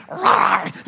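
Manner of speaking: mock dog roar, short and rough; imitated dog growl. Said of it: "like, freaking out".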